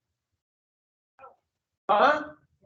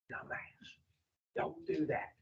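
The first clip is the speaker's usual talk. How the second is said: hushed, much softer warning; little inner voice; 4 semitones lower, 18 dB quieter than usual. Acted. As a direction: murmur it like a nagging conscience. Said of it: "do you hear a little voice say".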